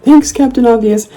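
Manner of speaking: high, sarcastic quip; sarcastic comeback. Retold or imitated. Answering a troll, sarcastic and high. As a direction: toss it back with mock thanks.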